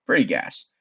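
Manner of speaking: usual speaking voice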